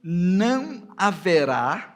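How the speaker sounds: slow, emphatic verse reading, punched openings; scripture reading. Acted. Preacher reads God's order about Anat to the congregation, slow and emphatic. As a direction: proclaim the verse slowly and loudly, pausing between phrases.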